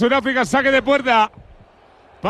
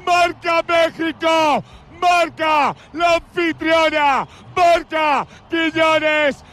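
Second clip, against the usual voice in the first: breakneck goal commentary; 6 semitones higher than usual. Real, not acted. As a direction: roar the goal like a live commentator. Live goal call by commentator.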